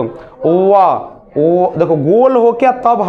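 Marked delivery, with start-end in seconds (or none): 0.0-0.7 व with rounded lips pulling away; v sound. Shown as a demonstration. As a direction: round the lips then release for व. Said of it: "और व बोल के देखो".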